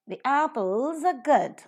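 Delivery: apples and good stressed, the weak. Shown as a demonstration. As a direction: model sentence with weak the and are. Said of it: "you could listen at the higher level, only apples and good".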